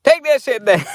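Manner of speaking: squeaky mock order to take it down; mock YouTube voice. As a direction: ham up a bossy YouTube. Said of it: "they're like".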